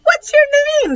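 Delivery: very high squawky parrot. Acted. Stink asks the parrot its name in parrot voice. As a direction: talk to the bird like a parrot. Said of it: "Stink asked in a parrot voice".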